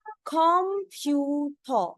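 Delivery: कंप्यूटर said slowly as khompyuto, the ending turned into an o; teacher showing Korean कंप्यूटर; Korean-style pronunciation. Shown as a demonstration. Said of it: "उसको ओ बना देते हैं".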